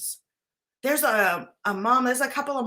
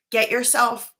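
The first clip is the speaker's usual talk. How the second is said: ordinary talk, nothing marked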